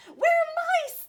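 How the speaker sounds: very high, loud squeak; squeaky mice. Acted. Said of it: "they squeaked".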